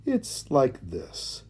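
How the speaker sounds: slow, soft tortoise voice; considering. A narrator's reading. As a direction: draw it out while weighing the answer. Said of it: "began the tortoise as he considered".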